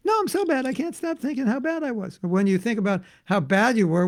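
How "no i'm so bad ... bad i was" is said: high, whiny mock lament; self-pitying devotee. A devotee beating himself up over a thought, high and whiny. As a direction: wail it like a hammed-up guilty devotee. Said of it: "Well, stop thinking about Sanskrit".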